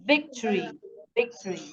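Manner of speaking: VIC stressed in victory, ending falling away; first-syllable stress. Shown as a demonstration. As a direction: model victory with the weight on VIC. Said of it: "emphasized on the first syllable".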